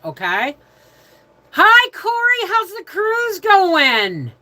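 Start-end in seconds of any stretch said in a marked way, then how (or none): 1.5-4.3 high, loud, drawn-out hello; excited greeting. Real, not acted. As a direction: call out a singsong welcome, stretching the end. Greets viewer joining stream.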